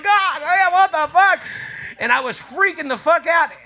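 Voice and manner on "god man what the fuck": shocked shout. Retold or imitated. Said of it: "I was like".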